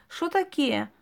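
put-on high, slow Ukrainian-accented telling; Ukrainian village accent. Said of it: "украинский анекдот". (с украинским говором, высоко и медленно)

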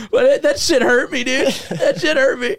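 high, laughing mock hurt. Real, not acted. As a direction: laugh through the complaint.

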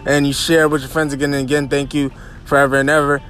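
upbeat radio host energy; podcast host intro. Acted. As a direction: hype up the show opening, high and lively. Podcast host opening the show.

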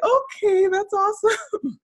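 high, bright retold "okay, that's awesome"; delighted recognition. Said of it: "So I was like".

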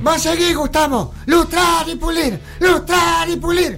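stern martial arts master. Acted. Master shouting orders.

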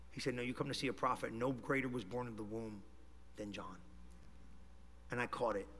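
low, soft, intimate retelling; hushed sermon storytelling. Acted. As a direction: draw the congregation in quietly.

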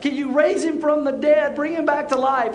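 imitating a desperate son, pitched high; high-pitched, insistent kid plea; pleading child. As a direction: beg dad like a freaked-out little boy. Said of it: "And then he's like".

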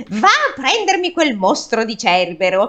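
put-on cranky old king, high and loud; irritated old man voice. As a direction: snap it like an annoyed old man. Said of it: "adesso è una voce diversa". (voce di anziano inviperito e fastidioso)

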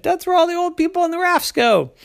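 put-on high, fast scornful child; a high little girl sneering; scoffing kid. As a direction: tease with scorn. Sister mocks old people.

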